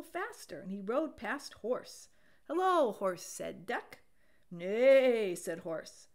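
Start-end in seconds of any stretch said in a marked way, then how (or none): 2.5-3.2 louder, cheery; loud hello. A narrator's reading. Duck calling hello to Horse loudly. 4.5-5.4 a big loud horse neigh. A narrator's reading. Horse answering Duck with a loud neigh.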